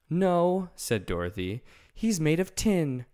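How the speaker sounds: Dorothy telling the lion about Tin Woodman; Dorothy's voice. Acted. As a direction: answer plainly and firmly. Dorothy answers.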